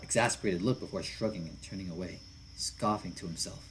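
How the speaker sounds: ordinary delivery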